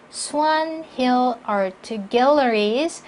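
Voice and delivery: each word of "Swan Hill Art Galleries" slow and distinct; deliberate beats on "Swan Hill Art Galleries"; slow, stressed words. Shown as a demonstration. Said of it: "천천히 또박또박 읽어주시면서 강세 주시고요". ("Swan Hill Art Galleries"를 천천히 또박또박)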